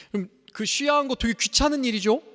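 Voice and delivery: ordinary talk, nothing marked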